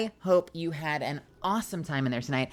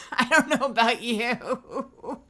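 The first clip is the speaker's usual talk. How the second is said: higher, slow and louder through laughter; laughing drawl; 7 semitones higher, 6 dB louder, much slower than usual. Real, not acted. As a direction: stretch it out while laughing. Repeats line, drawn out laughing.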